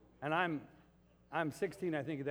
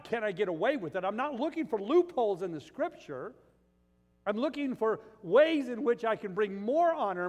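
loud, high, driving sermon voice; full-force preaching; 7 semitones higher, 8 dB louder than usual. Acted. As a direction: preach it hard to the congregation.

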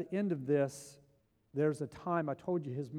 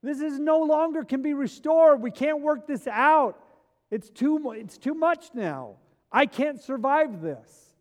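despairing cry; 12 semitones higher, 11 dB louder, slower than usual. Acted. Despairing voice acted in sermon.

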